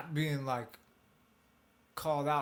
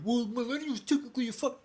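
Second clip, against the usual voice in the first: mocking whiny voice; 10 semitones higher, 3 dB louder than usual. Mocking someone's objection.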